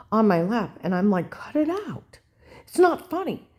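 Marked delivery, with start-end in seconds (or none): 1.3-3.5 high, indignant reprimand; retold scolding. Grandma telling ex-husband to stop, scolding and high. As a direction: tell them off, pitch jumping up.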